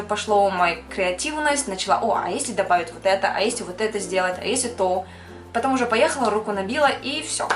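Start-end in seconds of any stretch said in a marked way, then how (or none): none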